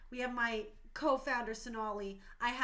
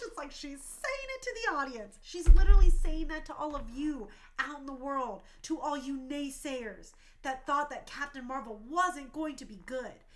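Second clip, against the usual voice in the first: usual speaking voice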